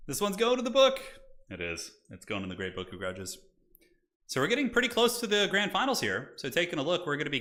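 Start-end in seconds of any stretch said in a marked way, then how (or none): 0.1-1.0 mock vow. 1.5-3.4 quiet grim mock vow. Mock grim vengeance, muttered.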